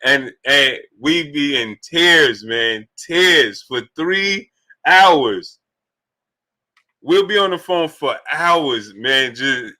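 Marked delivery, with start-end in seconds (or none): 3.0-5.6 drawn out. Real, not acted. Laughing about phone calls.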